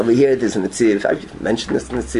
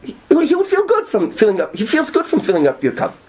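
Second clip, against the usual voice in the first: voiced advice; 12 semitones higher than usual. Preacher voicing gentle advice.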